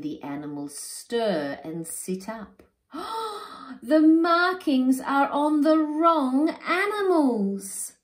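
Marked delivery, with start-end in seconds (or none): 2.9-3.8 high shocked gasp; sharp gasp. Acted. Animals waking to wrong markings, startled gasp. 3.8-7.9 much higher, louder and slow; shocked. Acted. Animals seeing the swapped markings, shocked loud cry.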